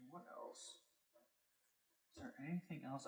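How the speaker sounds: very quiet, trailing musing aloud; muttered to self. Real, not acted. Host asks themself what game comes next, muttering. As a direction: think aloud under your breath, very soft. Thinking aloud between games.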